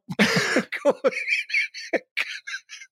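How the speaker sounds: airy wheezing giggle; breathy high laugh. Real, not acted. Host giggling after his own joke, airy wheeze.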